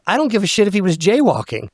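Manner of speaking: high-pitched, worked-up comeback; retold heated retort. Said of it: "I go".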